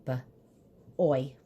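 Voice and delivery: "b, oi" split at the first sound; short b, pause, then oi. Shown as a demonstration. Teacher spelling boy for children, b and oi sounded out separately. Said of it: "I hear the oi".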